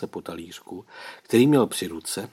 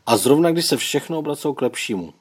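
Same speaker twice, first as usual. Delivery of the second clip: plain reading voice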